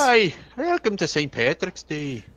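put-on haggard Irish accent. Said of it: "This is my haggard accent".

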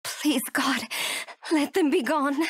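normal talking voice

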